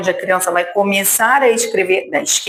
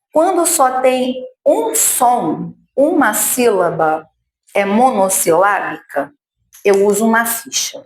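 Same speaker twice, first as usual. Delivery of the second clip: ordinary talk, nothing marked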